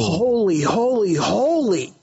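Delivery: each "holy" pitched high and falling, preacher-like; emphatic repetition. Shown as a demonstration. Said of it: "that's the very same emphasis".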